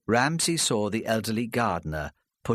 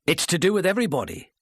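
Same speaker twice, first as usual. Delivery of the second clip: quick, raised, sharp retort; suddenly angry; 8 semitones higher, 3 dB louder, faster than usual. A narrator's reading. Ramsay turning on the witness, suddenly angry. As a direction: snap it out, fast and heated. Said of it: "he said, suddenly angry".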